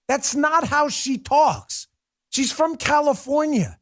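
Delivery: high, slow hammed-up Southern drawl; mock Southern accent. Host objecting to a politician's speech, in that same mock Southern drawl. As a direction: stretch every phrase in a fake Southern accent. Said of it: "the fake accents".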